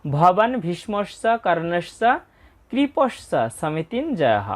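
ভবান ভীষ্মস্য কর্ণস্য said slowly and joined; teacher reads the line together slowly; slow joined recitation. Shown as a demonstration. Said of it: "উচ্চারণটা একসাথে করছি".

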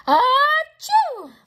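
loud, high faked sneeze; a big showy sneeze; fake sneeze. A narrator's reading. Chinchilla faking a loud sneeze to distract. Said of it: "The chinchilla sneezed".

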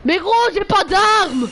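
high, slow, loud whine; whiny plea. Real, not acted. Pleading with teammates that he has no weapon, slow whine. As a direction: plead your innocence, dragging it out.

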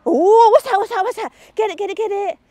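high, loud eager "get it" to the dog; excited urging. Real, not acted. Trainer urging Magpie to grab the dispensed treat, high and excited.

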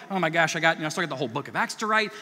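fast, flustered rush; hurried Luke. Retold or imitated. Luke rushing to finish his book, fast and flustered. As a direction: blurt it like a writer behind schedule. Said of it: "as he's like".

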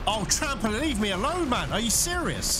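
high frustrated pleading; exasperated plea. Real, not acted. Gamer pleading with a machine that won't leave. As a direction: beg the machine to back off.

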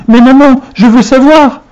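put-on high-pitched, insistent child's objection; protesting child. A narrator's reading. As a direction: beg mother to let you stay up. Boy protests being sent to bed.

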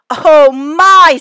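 loud, startled exclamation; shocked. A narrator's reading. Zoo director hearing the news, startled gasp. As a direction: gasp it out in alarm.

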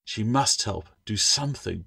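usual speaking voice

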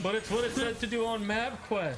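whiny son voice. Pretend. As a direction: protest like a scolded kid. Answers as the son.

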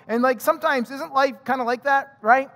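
usual speaking voice

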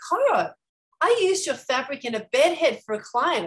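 high and loud, it suddenly clicks; excited realization. Retold or imitated. Telling Italian fabric makers she used their fabric, excitedly. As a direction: blurt it out as the name finally lands.

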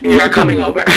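lightly voiced boyfriend; mock boyfriend. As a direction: put on a low dude voice. Boyfriend insists she come.

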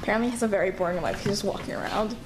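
fast nature documentary narration. Pretend. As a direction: narrate like a wildlife film. Narrating a day-in-the-life video.

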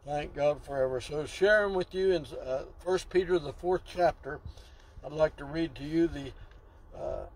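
ordinary talk, nothing marked

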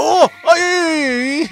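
high, sustained scream; demonstrated screaming. Shown as a demonstration. Streamer showing how screams move the cart, high shrieking. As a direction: let out a long high squeal. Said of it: "si yo estaría gritando en este momento".

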